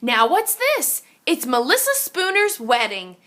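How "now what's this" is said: ordinary delivery, nothing marked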